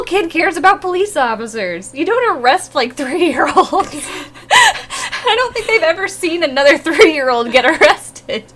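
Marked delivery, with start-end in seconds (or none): none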